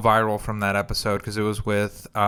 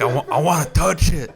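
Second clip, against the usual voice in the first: higher-pitched, eager mock questioning; nosy fascination; 6 semitones higher than usual. Acting out his nosy fascination.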